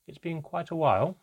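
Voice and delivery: ordinary delivery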